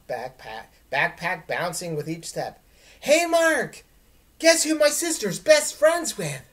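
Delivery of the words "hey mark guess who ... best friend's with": much higher, louder excited yell; shouting to a friend. Acted. Dylan yells news to his friend Mark, excited shout. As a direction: holler the news down the street.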